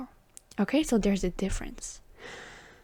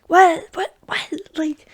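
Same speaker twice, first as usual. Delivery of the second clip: mock disbelief; 4 semitones higher, 5 dB louder than usual. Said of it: "You're like".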